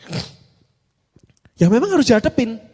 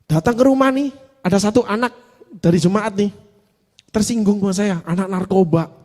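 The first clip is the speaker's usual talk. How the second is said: ordinary talk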